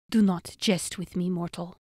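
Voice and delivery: understated irritated snap; snapped. A narrator's reading. Elf warns his mortal captive, snapping. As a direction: cut off the joking, a touch sharp. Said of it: "the elf snapped".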